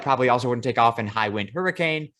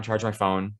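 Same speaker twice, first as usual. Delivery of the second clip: ordinary talk, nothing marked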